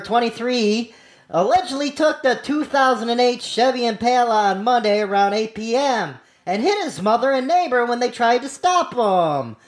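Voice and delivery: ordinary talk, nothing marked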